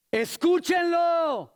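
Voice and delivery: high, drawn-out booming call; God's command. Acted. God commands the disciples from the cloud, high drawn-out cry. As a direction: stretch every syllable, ringing out high. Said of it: "salió una voz que dijo".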